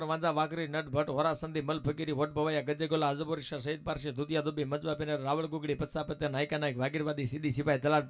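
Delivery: chanted roll-call in even pairs; rhythmic list recital. Acted. Recites long list of communities. (લયબદ્ધ યાદીનું સળંગ પઠન)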